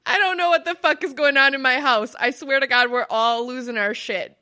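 loud, fast exasperated rant; exasperated outburst. Real, not acted. Venting to her co-host about the chaos at home, loud. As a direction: blurt it out loudly, at wits' end.

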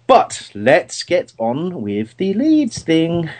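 a sudden comic voice for no reason; odd put-on voice. Real, not acted. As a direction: drag it out in a goofy voice. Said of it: "I don't know why I said it in that voice".

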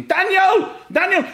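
high, loud yell of his name; shouting. Retold or imitated. Dad running to his son with the spray, shouting. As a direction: imitate a frantic dad calling across the pitch. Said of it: "So he's running and spraying, shouting".

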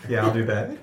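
normal talking voice

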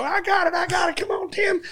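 high reenacted shout; retold cop shout. Reenacting his old video, he replays his own high shout. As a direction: hype it like a cop in a robbery scene.

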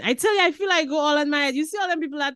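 breathless excited storytelling; excited rush. Real, not acted. Excited memory of dancing on car.

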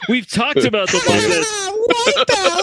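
high, slow, cracking into laughter; laughing take. Real, not acted. The intro line broken by laughter. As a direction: lose it on the last words.

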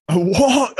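high, incredulous "a walk?"; stunned disbelief. Retold or imitated. Recovering patient to the physio, high disbelieving question. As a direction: hear the question as if it were impossible. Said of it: "I could have just cried".

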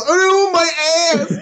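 mock distressed cry. Acted victim in imagined scene.

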